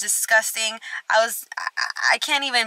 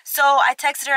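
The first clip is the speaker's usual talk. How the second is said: ordinary delivery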